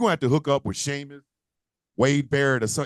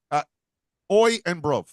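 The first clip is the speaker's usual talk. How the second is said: normal talking voice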